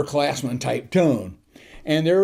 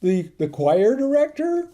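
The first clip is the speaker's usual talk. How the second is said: higher, halting question; tentative and bewildered, pitched up; lost, unsure; 6 semitones higher, slower than usual. Retold or imitated. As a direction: imitate a confused junior cadet groping for words. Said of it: "looked lost and he said".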